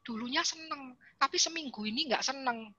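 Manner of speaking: put-on soft, small voice of a hurt little girl; a hint of a sad young daughter; daughter's sad answer. Bullied daughter answers her mother.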